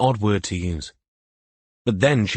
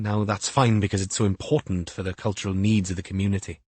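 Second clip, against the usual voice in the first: usual speaking voice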